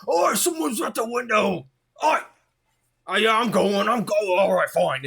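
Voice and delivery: high, loud put-upon worker voice calling back; a harried worker shouting from the back that he's coming; grumbling worker. Acted. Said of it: "and you hear".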